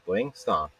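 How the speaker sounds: normal talking voice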